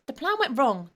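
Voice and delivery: loud snapped answer; an irritated boy snapping back. Acted. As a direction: snap it out angrily. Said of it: "the boy snapped".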